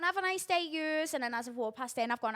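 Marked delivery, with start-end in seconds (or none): 0.0-1.1 higher, sugary mock-polite goodbye; fake-nice them on the way out; sarcastic sweet farewell. Leaving the cafe, a higher sarcastic mock-polite goodbye.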